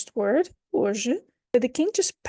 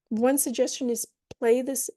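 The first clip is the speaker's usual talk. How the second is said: ordinary delivery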